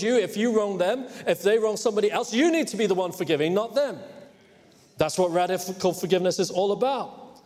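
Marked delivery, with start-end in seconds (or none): none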